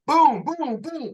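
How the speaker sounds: imitated gunshots.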